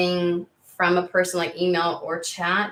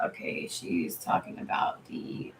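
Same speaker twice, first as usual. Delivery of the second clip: unvoiced, hushed, slowed aside; whispered aside; 8 dB quieter than usual. Real, not acted. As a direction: whisper it to yourself, slowly. Muttering while reading chat.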